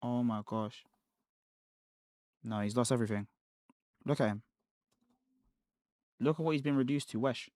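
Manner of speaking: muttered under the breath, nearly swallowed; low muttering. Real, not acted. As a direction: mumble it to yourself in disbelief. Streamer keeps mumbling low.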